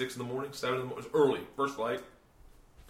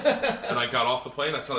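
ordinary delivery

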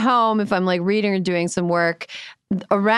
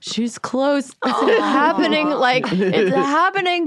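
higher, mock-dread voice for the dog; nervous dog's voice; 7 semitones higher, slower than usual. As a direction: play the dog bracing for trouble, pitched up. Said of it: "Luca will wake up and be like".

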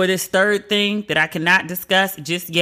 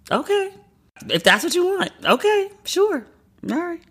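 mock resigned; 11 semitones higher than usual. Real, not acted. Resigned agreeing to audience.

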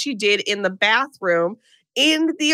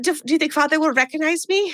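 mock foreign child accent, pitched higher; Esther's put-on accent again; 6 semitones higher than usual. Host re-quoting Esther about Father, in a mock accent. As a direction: repeat the line in Esther's strange accent. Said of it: "I'm so sorry about this accent".

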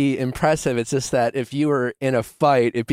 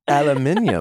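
imitated British pronunciation; 3 semitones higher than usual. Echoes the British pronunciation mockingly.

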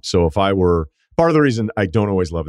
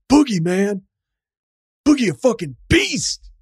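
swearing shout. Retold or imitated. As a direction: bellow it, staring him down. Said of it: "pacing and swearing about Boogie Cousins".

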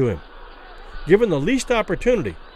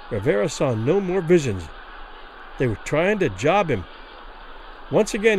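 ordinary delivery, nothing marked

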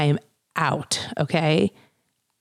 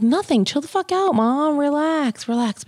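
exasperated high; 10 semitones higher than usual. Retold or imitated. Telling mom to calm down.

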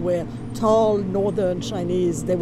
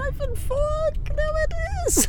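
much higher, thrilled exclamation; excited; 8 semitones higher than usual. Real, not acted. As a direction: squeal with delight at the sign. Spots beloved restaurant sign.

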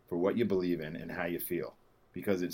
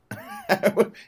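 high laugh; 14 semitones higher, 7 dB louder than usual. Real, not acted. Laughing after the outburst.

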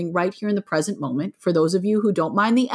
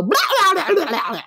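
silly yell-babble; 10 semitones higher, faster than usual. Shown as a demonstration. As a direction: blurt out goofy blah-blahs in place of a yell. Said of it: "open my mouth to yell and I'll go".

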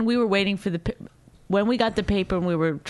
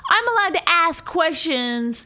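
high, drawn-out indignant protest; retold shouting match; 8 semitones higher, slower than usual. Wife imitates husband arguing with the court officer, indignant. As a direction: imitate him arguing back, pitched well up. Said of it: "So a shouting match starts".

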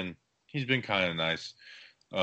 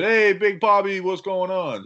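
loud, high Italian-American mobster greeting; big mock Italian New York hello; 10 semitones higher, 5 dB louder, slower than usual. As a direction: hail a regular in a hammed-up Italian accent. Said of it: "with the stereotypical, uh, Italian accent".

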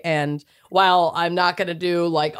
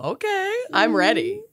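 high eager rising I'm ready; bright, eager, pitched up; excited; 11 semitones higher than usual. Real, not acted. Eager declaration repeated.

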